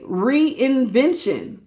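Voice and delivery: slow, stretched word; drawn out. Real, not acted. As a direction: read it out slowly and deliberately. Slowly reading the card.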